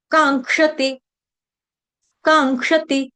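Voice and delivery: "कांक्षति" as kaang-ksha-ti, ng before the k; ng as in Ganga. Shown as a demonstration. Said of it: "गंगा वाला". ("कांक्षति" में गंगा वाला ङ)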